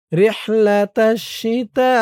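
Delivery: slow, melodic tajweed chant; Quran recitation. Shown as a demonstration. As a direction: recite slowly like a qari. Recites the verse. (ধীর সুরেলা তিলাওয়াত)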